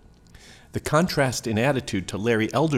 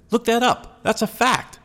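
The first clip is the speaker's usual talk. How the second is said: emphatic insistence; 12 semitones higher, 4 dB louder than usual. Retold or imitated. Quoted insistence added for impact.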